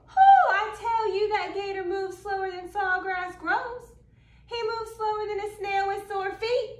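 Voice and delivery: lively storytelling. A narrator's reading. Reading a picture book to kids, lively storytelling. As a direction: spin the tale to a room of children.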